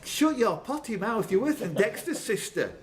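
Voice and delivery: high mock telling-off through a laugh; play-scold him, half laughing. Quizmaster teasing a rude contestant, mock scolding.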